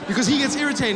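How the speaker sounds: normal talking voice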